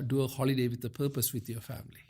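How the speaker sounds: ordinary talk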